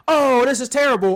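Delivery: loud, high mock-horrified announcer; hammed-up outcry of dismay. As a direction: imitate a commentator appalled on air. Acts out announcers' shocked reaction.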